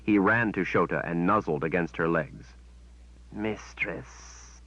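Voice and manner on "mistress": soft, purring creature voice. Acted. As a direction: purr it like a fawning beast. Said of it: "he purred".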